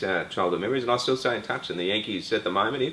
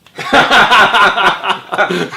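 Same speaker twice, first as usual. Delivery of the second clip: big laugh; 13 semitones higher, 17 dB louder than usual. Real, not acted. Story of the kids mocking him.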